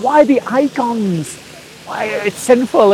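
imitating his earnest younger self's protest; pleading objection. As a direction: act out a troubled youngster asking why. Acting out his troubled questions to monk.

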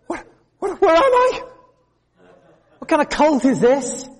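high, rising panic, voice climbing; a frightened dignified lady squeaking in alarm; terrified. Retold or imitated.

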